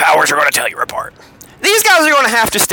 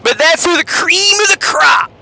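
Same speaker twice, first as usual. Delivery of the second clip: wrestling promo; 8 semitones higher, much slower than usual. Pretend. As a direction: cut a fiery wrestling promo. Cuts promo on fraudsters.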